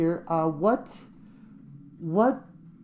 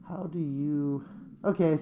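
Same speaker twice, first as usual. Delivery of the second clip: usual speaking voice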